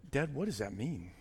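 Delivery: put-on child in the pew leaning over to Dad, softly asking; hushed child question. Child whispering to Dad in church.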